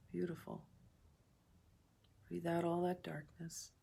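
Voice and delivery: calm meditation guide with long pauses between phrases; guided meditation. Real, not acted. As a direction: ease listeners through each breath, unhurried.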